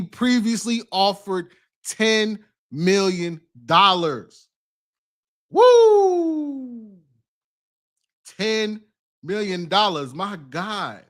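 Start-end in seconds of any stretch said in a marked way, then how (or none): 5.5-7.0 long high-pitched woo; loud whoop trailing downward; drawn-out astonished woo. Real, not acted. Reacting to the settlement figure.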